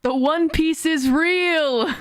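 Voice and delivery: high, slow, stretched-out proclamation; Whitebeard's scream. Acted. Streamer repeats Whitebeard's quote for the AI, a drawn-out cry. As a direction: cry it out long and high, like last words. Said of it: "he screams".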